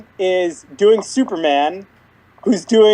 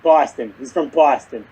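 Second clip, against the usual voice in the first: put-on a light Brooklyn accent, meant as Boston; 4 semitones lower than usual. Fan imitates the character's Brooklyn accent. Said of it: "you did a Brooklyn".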